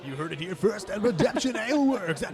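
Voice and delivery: booming radio announcer; loud, high announcer read. Acted. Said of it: "It's a good radio voice".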